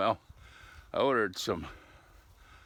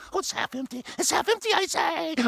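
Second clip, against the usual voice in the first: much higher, louder cartoonish insisting; silly high cartoon voice; 7 dB louder than usual. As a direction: insist in a goofy put-on voice. Silly voiced arguer answers.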